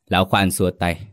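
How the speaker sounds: ordinary delivery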